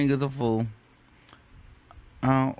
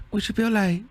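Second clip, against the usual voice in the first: high, cool mimicked challenge; 6 semitones higher than usual. Fondly mimicking the rapper's challenge, high and even. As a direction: repeat it in a light imitation. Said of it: "shout out to [name]".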